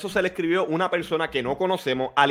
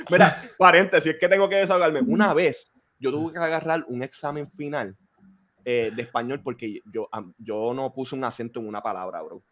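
normal talking voice

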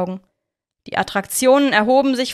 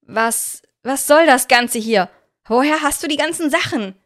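loud, higher, upset questions; shouting; 4 semitones higher, 4 dB louder than usual. A narrator's reading. Annika shouting at Victor through the bars. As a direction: hurl the questions at him. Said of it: "schrie ihm Annika entgegen".

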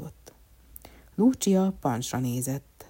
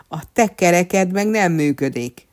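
loud, raised-pitch comeback; retorting; 4 semitones higher, 10 dB louder than usual. A narrator's reading. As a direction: fire back at the insult. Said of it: "vágott vissza az asszony".

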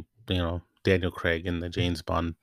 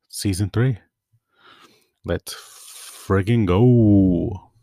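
hyped; 6 dB louder, much slower than usual. Real, not acted. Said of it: "hyping up the new season".

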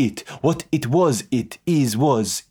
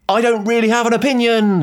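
higher, mock-cried joke line; 9 semitones higher than usual. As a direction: cry it out just to join in. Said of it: "Cried [name]".

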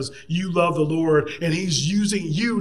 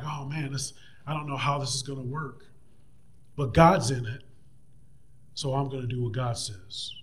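self-conscious doubt; 4 semitones lower, 8 dB quieter than usual. Retold or imitated. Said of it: "some self-consciousness going on".

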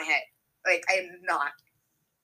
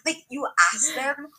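whispered aside; 5 semitones higher, slower than usual. Real, not acted. Friend whispering to friend about parents. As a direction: breathe it out slowly as a secret.

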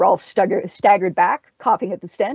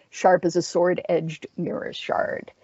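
plain reading voice

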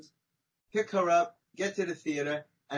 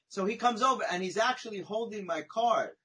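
usual speaking voice

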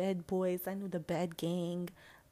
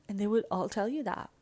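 usual speaking voice

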